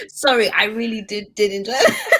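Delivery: high, loud, laughing all through; giggling helplessly at the joke. Real, not acted. Host reacting to a co-host's joke, laughing hard.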